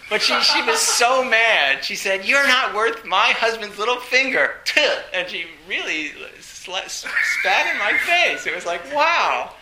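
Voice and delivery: loud, laughing disbelief; can't get through it without laughing; half-laughing retelling of being spat on; laughing while telling. Real, not acted.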